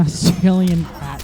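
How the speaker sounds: ordinary talk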